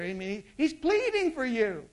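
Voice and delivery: usual speaking voice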